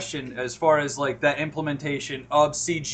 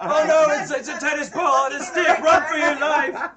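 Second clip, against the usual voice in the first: high, hammed-up terror at a prop; mock panic; 11 semitones higher, 5 dB louder than usual. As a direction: fake fleeing for your life, loud and laughing. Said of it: "look at a green screen and go".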